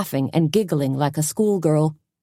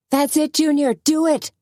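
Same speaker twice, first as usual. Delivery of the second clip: very high excited cheer; screaming encouragement; 11 semitones higher than usual. A narrator's reading. Suzanne cheering Junior on, high excited scream. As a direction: scream him on. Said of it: "Suzanne screamed".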